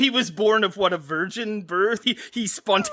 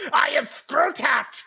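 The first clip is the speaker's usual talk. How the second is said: booming echoing villain monologue; slower than usual. Pretend. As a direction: announce yourself as the evil overlord. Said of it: "a villain in a movie".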